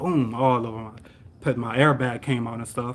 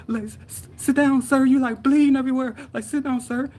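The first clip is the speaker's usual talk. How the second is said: high-pitched urgent pleading; imitating concerned lady; 13 semitones higher than usual. Lady to a bleeding driver, high and urgent. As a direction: play a bystander fussing over the injured. Retold lady urging injured man.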